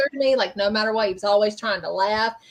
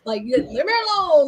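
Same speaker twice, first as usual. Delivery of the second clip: put-on higher, indignant old man telling them off; a proud grandpa snapping back at someone buttoning his pants; scolding grandpa; 9 semitones higher than usual. Grandpa scolding a helper who buttons his pants, higher indignant old-man voice. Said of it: "he'd get on to him".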